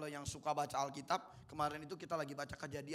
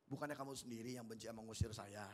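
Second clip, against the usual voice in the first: much lower and softer, mild and resigned; calm, not angry; 9 semitones lower, 10 dB quieter than usual. Shown as a demonstration. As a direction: say it quietly, hurt rather than angry. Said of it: "Atau kira-kira Yefta ngomong gini".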